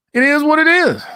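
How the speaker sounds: high, loud put-on shrug of a voice; a mock-resigned high sing-song. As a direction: toss it off in a silly high voice. Resigned shrug at cheating wife.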